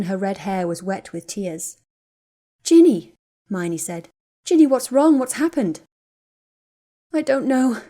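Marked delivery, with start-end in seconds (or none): 2.6-3.1 sudden loud, high call of her name; alarmed cry. A narrator's reading. Hermione finds Ginny sobbing. 4.4-5.8 alarmed. A narrator's reading. Hermione alarmed by crying friend. 7.1-7.8 forced through tears. A narrator's reading. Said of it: "forced the words out and started crying harder".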